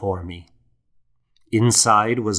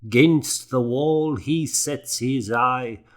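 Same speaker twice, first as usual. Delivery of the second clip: slow, higher, measured recitation; reciting a poem; 4 semitones higher than usual. A narrator's reading. Old scribe recites his cat poem for the young monk. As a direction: savour each rhymed line like verse. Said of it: "Luke recited".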